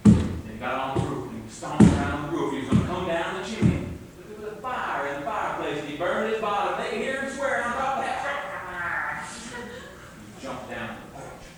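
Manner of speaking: unhurried folk-tale telling; storytelling narration. A narrator's reading. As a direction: spin the tale for the listeners. Folk tale told to audience.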